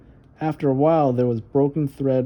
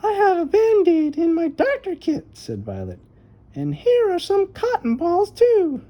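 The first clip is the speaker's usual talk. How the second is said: put-on much higher eager little girl voice; a proud little doctor showing her kit; little girl Violet; 3 dB louder than usual. A narrator's reading. Little sister offering a Band-Aid to her brother, eager high girl's voice.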